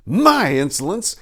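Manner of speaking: high, loud, slow indignant question; hot anger. A narrator's reading. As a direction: throw the word back in anger. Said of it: "asked Casimir in hot anger".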